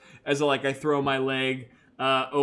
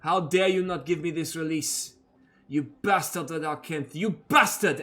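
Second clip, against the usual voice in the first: a touch of Danica's rage; 2 semitones higher than usual. Acted. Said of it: "and she goes".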